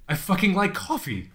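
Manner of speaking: loud, high insistence; emphatic. Retold or imitated. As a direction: punch it out with glee. Emphatic retold retort.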